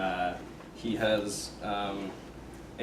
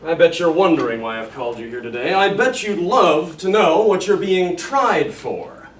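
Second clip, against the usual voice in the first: stern colonel, baiting the accused; Colonel Cathcart, smugly menacing; 6 semitones higher, 9 dB louder than usual. Acted. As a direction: draw it out like a commander savouring an accusation. Plays Cathcart questioning his officer.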